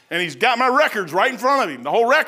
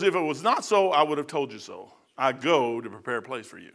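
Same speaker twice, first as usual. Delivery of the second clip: tender promise; 7 semitones lower, 5 dB quieter than usual. Acted. Preacher voices Jesus' promise.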